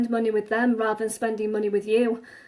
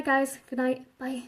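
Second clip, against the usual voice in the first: ordinary talk, nothing marked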